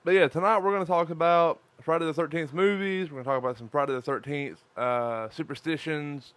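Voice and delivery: normal talking voice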